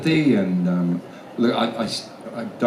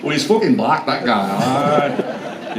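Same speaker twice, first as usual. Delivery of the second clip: mock northern English accent; British accent; 4 semitones higher, 3 dB louder, slower than usual. Shown as a demonstration. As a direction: put on a lively English regional accent.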